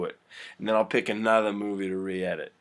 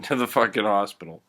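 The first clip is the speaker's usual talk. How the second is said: ordinary talk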